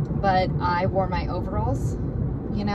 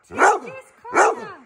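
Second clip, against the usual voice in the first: high pet-talk voice; 10 semitones higher, 5 dB louder than usual. Real, not acted. Owner telling dog its spot in baby talk. Talking to the dogs.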